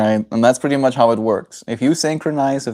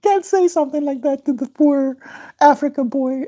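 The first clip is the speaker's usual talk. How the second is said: mimicking Shay. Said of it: "And Shay goes".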